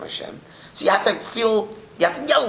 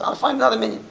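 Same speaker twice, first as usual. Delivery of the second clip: higher pitched, huffy retort; indignant retort; 9 semitones higher, faster than usual. Retold or imitated. Chassid storming out on the Rebbe, indignant and higher. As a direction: toss it off while storming out.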